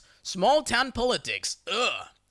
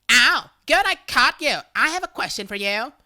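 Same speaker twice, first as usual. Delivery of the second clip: put-on higher, louder pushy older woman voice; breathless older woman, higher and louder; imitating Miss Mayor; 5 semitones higher, 6 dB louder than usual. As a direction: play the eager busybody who finally caught you. Neighbor voiced chasing narrator down.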